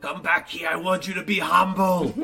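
high, loud hammy villain taunt; mustache twirling villain. Pretend. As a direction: gloat like a cartoon baddie. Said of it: "Remind me as I just twirl my mustache".

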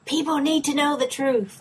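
high, insistent mock reporter voice; hammed-up, high-pitched demand; pushy news anchor. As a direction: imitate a reporter getting in someone's face. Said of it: "she goes in Sydney's face going".

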